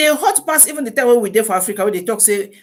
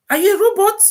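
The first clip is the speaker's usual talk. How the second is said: "robot" pitched up high in disbelief; incredulous high squeak; 9 semitones higher, 4 dB louder, faster than usual. Real, not acted. To overworked mothers, asking in high disbelief. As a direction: ask "Are you a robot?" shooting way up in pitch. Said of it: "Are you a robot to take care".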